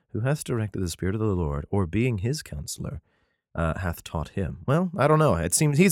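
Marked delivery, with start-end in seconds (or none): 0.1-4.6 softer, careful second reading of the verse; rereading the verse to himself; quiet reread. Shown as a demonstration. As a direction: reread it under your breath to check it. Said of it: "Um, hold on".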